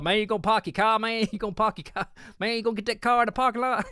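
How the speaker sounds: hammed-up Kentucky hillbilly, pitched high; Kentucky drawl. Answering northerners, hammed-up high Kentucky accent. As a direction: exaggerate his own Kentucky accent on park your car. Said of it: "And I'll be like".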